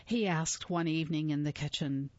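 ordinary delivery, nothing marked

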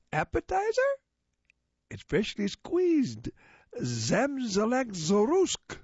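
high, slow alien host. Acted. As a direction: draw it out like a strange alien host. Alien offers food.

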